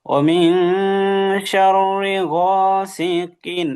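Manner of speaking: teacher's ringing model of গাসিকিন; tajweed demonstration. Shown as a demonstration. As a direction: draw out ওয়া মিন and গাসিকিন in recitation. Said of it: "কিন শোনা গেছে".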